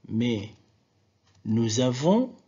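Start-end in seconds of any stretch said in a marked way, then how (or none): 1.4-2.3 Z linking "nous avons"; liaison. Shown as a demonstration. Teacher contrasting with "nous sommes", Z linked in "nous avons". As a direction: join "nous avons" with a Z, ending on a rise. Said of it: "il y a une liaison".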